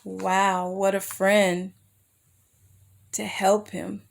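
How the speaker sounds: plain reading voice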